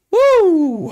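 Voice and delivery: loud high woo; battle mode cheer; hype shout. Real, not acted. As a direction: shout it out, fired up. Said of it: "got me in here in battle mode".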